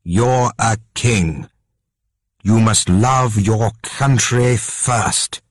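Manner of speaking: slow, lightly voiced stern mother; queen mother lecturing the king, slow. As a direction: lay down the duty slowly.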